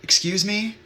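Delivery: put-on high, tentative child's question; little kid. Little kid to crying stranger on the path, high child's voice. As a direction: imitate a small kid piping up. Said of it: "this other little kid came up".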